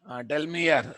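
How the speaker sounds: normal talking voice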